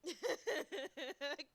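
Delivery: rising excited giggle. Real, not acted. Gamer laughing at the colour, rising giggle. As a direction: burst into a giggle.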